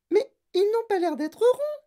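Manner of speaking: put-on very high, loud child character voice; Veruca Salt. A narrator's reading. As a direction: protest in a piercingly high voice. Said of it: "dit Veruca Salt". (voix suraiguë de fillette qui proteste)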